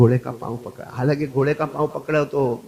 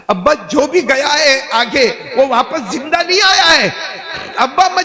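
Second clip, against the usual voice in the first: high, loud dramatized begging; desperate plea; 13 semitones higher, 6 dB louder than usual. Retold or imitated. Daughter warning her father nobody returns alive, acted desperate cry. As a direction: cry it out as a child's urgent appeal.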